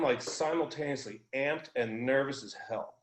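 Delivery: ordinary delivery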